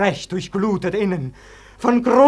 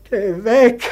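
verzweifelter Ruf; 3 semitones higher, 6 dB louder than usual. Acted. Tor wehrt den Tod ab.

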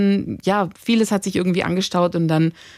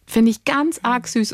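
ordinary talk, nothing marked

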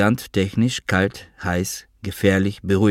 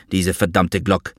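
plain reading voice

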